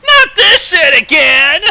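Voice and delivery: loud groaning outburst; exasperated shout. Real, not acted. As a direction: yell it like you're fed up. Friend groans at repeated trope.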